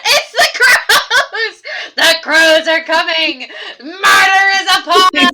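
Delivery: loud, laughing alarm call; mock panic. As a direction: cry out the warning, hammed-up. Mock alarm about crows.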